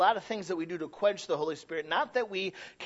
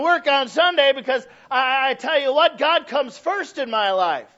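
loud high proclamation; preaching shout; 8 semitones higher, 8 dB louder than usual. Real, not acted. Preacher shouts to his church, loud and high. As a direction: call it out to the congregation.

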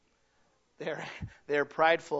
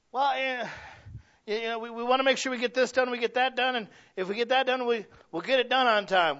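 higher, quicker, hurried excuse-making voice; mock eager excuser; 7 semitones higher, 5 dB louder than usual. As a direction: mimic someone rushing to justify themselves. Imitating someone who jabs at you.

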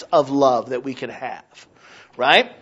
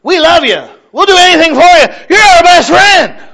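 much higher, shouted fake love; mock gushing shout; 12 semitones higher, 11 dB louder than usual. Preacher parodying a church shouting fake love. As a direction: yell the syrupy welcome like a caricature.

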